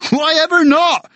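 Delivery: gleeful guide; bird guide. Acted. Guide replying.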